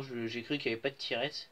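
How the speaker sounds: normal talking voice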